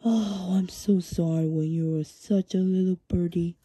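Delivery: loud, sneering mock pity; loud mocking taunt. Villain mocking the young crow as a little birdie, loud and sneering. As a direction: taunt him like a little kid.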